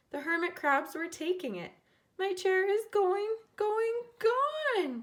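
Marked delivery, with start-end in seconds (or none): 2.2-5.0 very high and loud wail; baby's dismayed high wail; crying out. A narrator's reading. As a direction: wail as the chair vanishes. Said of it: "she cried".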